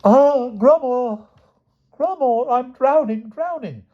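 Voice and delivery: very high, slow swooning wail; the queen theatrically begging Grummore to save her; swooning damsel. Acted. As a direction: swoon over him, hamming it up. Queen overacts drowning again.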